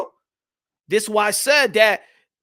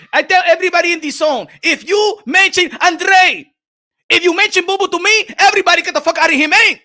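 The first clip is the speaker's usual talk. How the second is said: louder, higher hammed-up boss rant; mock Canelo rant; 5 semitones higher, 5 dB louder than usual. Canelo as imagined banning talk of Andrade, hammed-up loud rant. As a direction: bark it like a mock tyrant boss.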